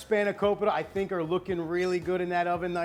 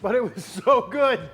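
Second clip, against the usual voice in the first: laughing; 4 semitones higher, 7 dB louder than usual. Real, not acted. Laughing at burning mouth.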